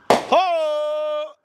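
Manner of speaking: long, high "oh" cry; high-pitched holler. Real, not acted. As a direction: holler a drawn-out high note. Reacting to Fourth of July bangs.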